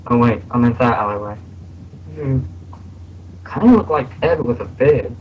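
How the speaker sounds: Ed from What the Future, lines rattled off quick; Ed impression. Voice actor showing fans his Ed impression, quick and animated. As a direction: act out Ed's cartoon scene, hoarse from a cold. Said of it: "this is my impression".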